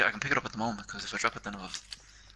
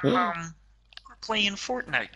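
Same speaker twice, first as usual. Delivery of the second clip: higher frog puppet voice; Kermit the Frog; 8 semitones higher than usual. Acted. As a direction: keep the Kermit voice going. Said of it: "[name]'s Kermit the Frog voice".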